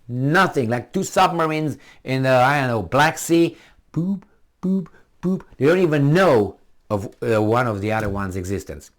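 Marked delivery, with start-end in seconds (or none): 3.9-5.4 high, light, spaced boops; ping like a sonar, evenly spaced; imitated sonar pings. Two submarines in the sea, high sonar boops.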